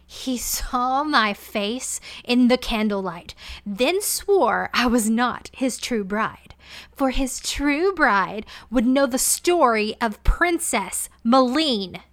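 enraged queen screeching at her maid; screeching rage. Acted. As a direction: spit every word with venom. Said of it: "She screeched like a banshee".

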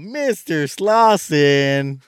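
high, loud, slow stretched cartoon cry; Scooby-Doo reveal. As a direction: ham up the cartoon unmasking.

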